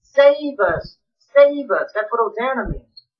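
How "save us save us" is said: raised, pleading cry to be saved; rising crowd plea, each save us louder. Acted. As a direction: call it out like a begging crowd. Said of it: "the people are crying out".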